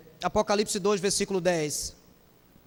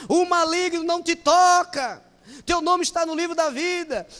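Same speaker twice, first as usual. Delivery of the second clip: mock exalted preacher; 7 semitones higher, 6 dB louder than usual. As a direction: declaim it like a zealous preacher. (pregador exaltado, mais alto e mais forte, devagar)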